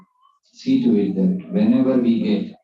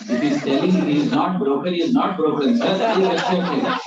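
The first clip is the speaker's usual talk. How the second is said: laughing plea; 6 semitones higher, 5 dB louder than usual. Acted. Teacher pleads for honest boy.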